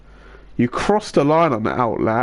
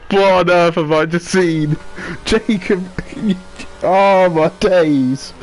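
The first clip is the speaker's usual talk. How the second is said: shocked laughter; 6 semitones higher, slower than usual. Real, not acted. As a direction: cry out through laughter. Crash reaction, laughing.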